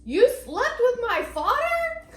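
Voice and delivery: put-on high, slow child's loud question; imitating child. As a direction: shout it like a kid, out in public. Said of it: "and Henny goes".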